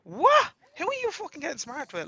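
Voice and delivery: high, loud "what?" then heated challenge; retold flare of indignation; indignant comeback. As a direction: act out outraged disbelief, pitch leaping up. Retold angry comeback to the lip.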